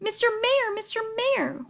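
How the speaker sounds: high, urgent repeated call; insistent calling. A narrator's reading. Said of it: "Horton called".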